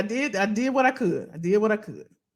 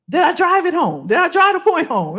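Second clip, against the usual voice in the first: raised, louder questions to the audience; emphatic challenge; 7 semitones higher, 6 dB louder than usual. Real, not acted. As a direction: press the point home, high and loud. Pressing the audience after her point.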